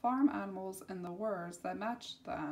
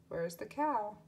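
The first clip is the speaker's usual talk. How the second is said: ordinary talk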